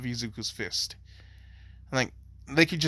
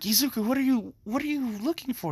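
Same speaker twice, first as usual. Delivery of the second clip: put-on high, puzzled girl voice; Momo's voice; 11 semitones higher than usual. Momo asks Izuku what he's searching for, high and puzzled. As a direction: pitch up into a confused Momo.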